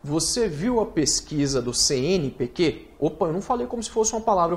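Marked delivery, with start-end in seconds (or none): none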